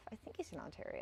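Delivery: unvoiced aside; whispering. Real, not acted. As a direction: drop to a whisper. Trails off into a whisper.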